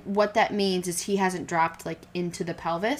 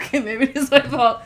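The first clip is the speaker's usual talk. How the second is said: laughing concession; 6 semitones higher, 8 dB louder than usual. Real, not acted. Teased by husband, concedes laughing.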